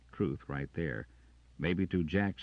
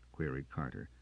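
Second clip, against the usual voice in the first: ordinary delivery, nothing marked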